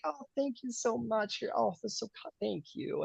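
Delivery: high, saccharine put-on gratitude; fake sweet thanks. Lady performs gratitude, high and sugary. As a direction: gush falsely, pitch up. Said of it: "putting on all of the show of".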